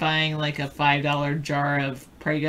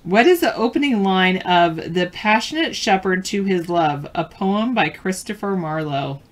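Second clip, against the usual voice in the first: louder quiz host reading the question; trivia question read; 2 semitones higher, 5 dB louder than usual. Acted. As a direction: read it out clearly to the players.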